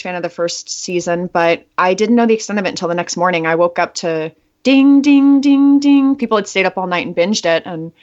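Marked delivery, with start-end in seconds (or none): 4.6-6.1 imitated phone pings, rising louder; ding ding ding. Woke to phones pinging, imitating the alerts. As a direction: sing out each ding like a notification.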